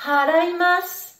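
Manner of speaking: haraimasu with a strong breathed H you feel on the hand; aspirated H. Shown as a demonstration. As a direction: exhale hard on the H of haraimasu. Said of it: "tu expires bien le H". (haraimasu, le H bien soufflé)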